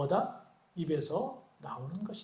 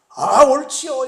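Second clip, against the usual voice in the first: eager exclamation; 6 semitones higher, 12 dB louder, much slower than usual. Retold or imitated. Woman answers Jesus eagerly.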